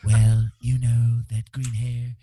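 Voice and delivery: drawn-out mock-president impression; comic send-up of the president; Donald Trump. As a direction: stretch the opening words like a blustering politician. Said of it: "That's actually quite a good impression".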